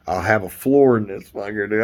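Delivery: ordinary delivery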